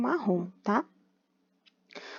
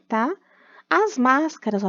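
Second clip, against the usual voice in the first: normal talking voice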